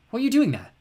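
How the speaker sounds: plain reading voice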